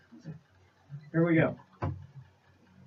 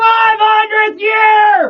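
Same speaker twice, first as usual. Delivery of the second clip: high, drawn-out full-force shout; band-name shout; 14 semitones higher, 11 dB louder, slower than usual. Pretend. Band opens its radio station promo, loud drawn-out shout. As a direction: belt it out like a promo intro.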